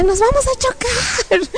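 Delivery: high, drawn-out panicked wail; alarmed cry. Retold or imitated. Flyer seeing columns ahead, alarmed cry. Flying toward columns, crying out.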